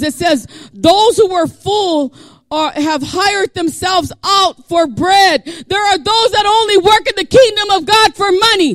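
loud, high, shouted sermon cadence; full-force preaching. Real, not acted. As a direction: cry out a warning to the congregation.

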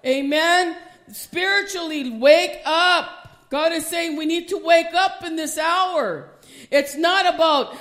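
loud, high, driving sermon voice; preaching at full force. Acted. Preacher urging the congregation, full-force preaching. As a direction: preach it hard and rising.

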